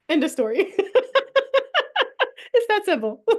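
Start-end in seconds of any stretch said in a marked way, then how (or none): 0.8-2.5 high giggling laughter. Real, not acted. Laughs after "end of story.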